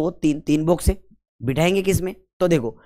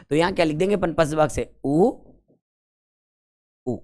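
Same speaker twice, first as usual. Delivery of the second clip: normal talking voice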